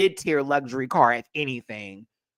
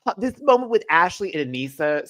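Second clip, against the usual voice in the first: usual speaking voice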